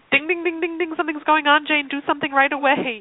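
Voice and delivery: high, urgent alarm ringing in her head; alarm bell voice. Retold or imitated. Her body sounding the alarm to herself, high and urgent. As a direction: ring it out like a frantic inner alarm. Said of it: "my body is just like".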